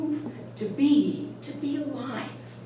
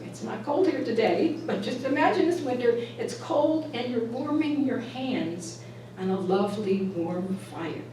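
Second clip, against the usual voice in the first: normal talking voice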